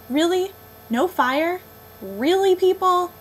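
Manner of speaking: loud, high, rising disbelief; incredulous scolding. Real, not acted. Reviewer scolding campers for having no fire, incredulous. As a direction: scold them like you can't believe it.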